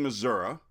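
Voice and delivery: "Missouri" said muh-ZUR-uh; Missouri ending in "uh". Shown as a demonstration. Native recalling his childhood pronunciation, "Missouri" with final uh. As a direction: finish "Missouri" on an uh, not ee. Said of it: "I grew up saying".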